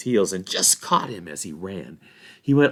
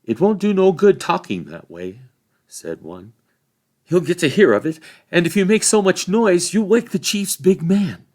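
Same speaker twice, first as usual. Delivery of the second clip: higher, louder urgent warning; fearful warning; 10 semitones higher, 10 dB louder than usual. A narrator's reading. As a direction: warn them anxiously to hush. Frightened hobbit warns.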